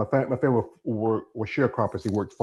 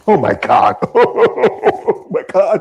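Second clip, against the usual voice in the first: laughing hard, higher and louder; laughing oh my god; 7 semitones higher, 9 dB louder, much slower than usual. Real, not acted. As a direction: burst out laughing through the words. Laughing at political joke.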